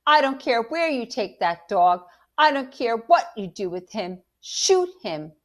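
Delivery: loud, high, furious tirade. A narrator's reading. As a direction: rant orders at full anger. Angry mother orders dog shot.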